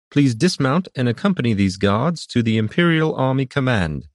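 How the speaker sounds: high-pitched official herald voice; officious herald reading out orders; matter-of-fact herald. A narrator's reading. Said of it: "the herald said matter-of-factly".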